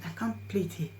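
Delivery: ordinary delivery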